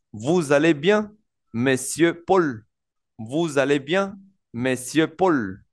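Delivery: "monsieur Paul" muy pausado y descendente; lectura lenta en francés. Shown as a demonstration. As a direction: marca cada sílaba con calma. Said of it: "en el de abajo dice". (profesor lee el ejemplo con "monsieur Paul" despacio)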